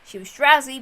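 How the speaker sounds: ordinary delivery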